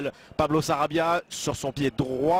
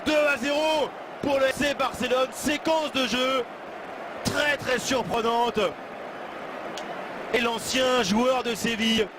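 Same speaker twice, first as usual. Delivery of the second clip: excited sports commentary; 7 semitones higher than usual. Real, not acted. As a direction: belt out the goal live. Goal called live.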